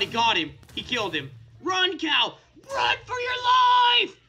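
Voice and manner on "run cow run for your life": desperate yell. Pretend. Player begging favorite cow to flee, desperate yell. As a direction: shout building to a high, stretched scream.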